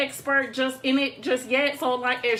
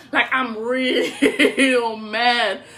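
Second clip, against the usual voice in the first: laughing; 4 semitones lower, 3 dB louder, much slower than usual. Real, not acted. Laughing about own anger.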